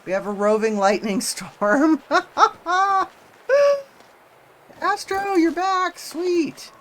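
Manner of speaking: high, loud excited shouting. Real, not acted. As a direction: call it out with delight. Storm and friend arriving.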